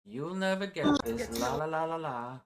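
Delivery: high playground chant; sing-song taunt. Pretend. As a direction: tease in a playful sing-song. Repeats the sing-song taunt, playfully.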